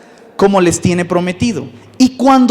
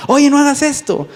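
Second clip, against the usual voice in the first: higher, half-shouted scold; mock scolding mother; 7 semitones higher than usual. Mother yelling at her daughter, mock shout. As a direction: play the nagging mom yelling.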